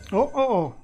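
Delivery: high, loud rising oh oh oh; excited. Real, not acted. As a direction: gasp with real hype at the roll.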